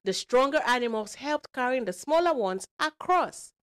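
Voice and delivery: put-on animated, emphatic storyteller for kids; lively read-aloud to young listeners; children's storytelling. A narrator's reading. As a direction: drive the story along with bold swoops. Tells a children's story with character voices.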